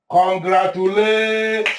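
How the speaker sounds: congratulations stretched out slowly; drawn-out proclamation. Acted. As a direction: proclaim it, holding the vowels long. Announcing the newly elected king.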